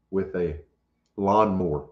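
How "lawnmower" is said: LAWNMOWER squashed to two syllables, "LAHN-more"; childhood pronunciation. Shown as a demonstration. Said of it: "That's how it was pronounced".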